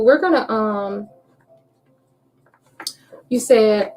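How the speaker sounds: ordinary talk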